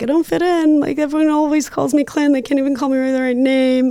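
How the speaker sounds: a hint of a child complaining; whiny child. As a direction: mildly imitate a hurt little girl. Retelling her childish complaint.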